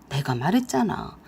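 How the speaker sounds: plain reading voice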